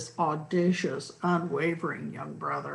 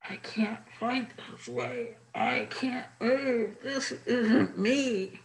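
muffled from inside a helmet; 4 semitones higher, slower than usual. Acted. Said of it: "a muffled voice was heard".

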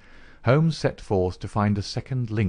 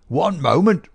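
higher, louder querulous call from the doorway; querulous cry; 8 semitones higher, 7 dB louder than usual. A narrator's reading. Old man interrupting Holmes at the table, querulous cry. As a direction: cut in sharply, demanding a halt. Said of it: "cried a querulous voice".

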